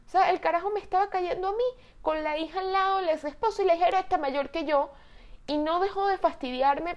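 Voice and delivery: pitched high all through, incredulous; indignant disbelief. Real, not acted. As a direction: pile up the outrageous facts in a high voice. Indignant retelling of his advances. (indignada e incrédula, con voz aguda)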